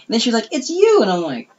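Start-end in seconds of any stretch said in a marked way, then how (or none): 0.5-1.0 much higher, louder singsong reveal; teasing coworker. Retold or imitated. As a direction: tease like a gleeful gossip. Voicing Cheryl's reveal.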